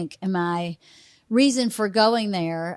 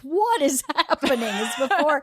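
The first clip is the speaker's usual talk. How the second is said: laughing disbelief; 4 semitones higher than usual. Retold or imitated. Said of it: "And I was like".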